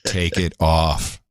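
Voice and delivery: low, drawn-out sultry command; slow mock seduction. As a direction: stretch each word out, deep and teasing. Repeats mock seductive request, slower.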